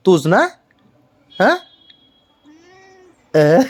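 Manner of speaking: usual speaking voice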